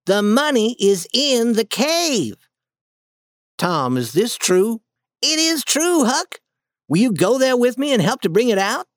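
put-on boy Tom pressing his friend, eager; Tom voiced, excited. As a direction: Tom urging Huck, eager. Tom insists, asks Huck's help.

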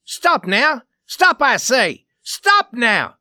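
high, loud order to stop; loud commanding shout. A narrator's reading. Scully's role breaking up a brawl, loud commanding shout. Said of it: "Scully's voice was the loudest".

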